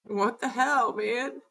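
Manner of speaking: soft, tearful disbelief; quietly choked up, really overwhelmed; near tears. Real, not acted. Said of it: "I don't know why I wanna cry".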